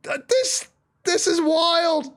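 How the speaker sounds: very high, stretched-out astonishment; high-pitched and overwhelmed by the action; amazed commentary. Real, not acted. Caster marvels at a wild fight, amazed.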